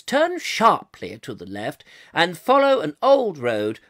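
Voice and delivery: put-on plummy BBC accent, every word weighed. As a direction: read it in posh, deliberate BBC English. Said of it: "another bit of the B B C".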